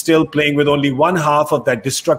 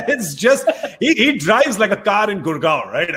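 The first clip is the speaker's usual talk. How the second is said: amused, high-pitched, talking through laughter; laughing; 4 semitones higher than usual. Real, not acted. Presenter joking about the bowler's action, laughing. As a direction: let real laughter run through the joke.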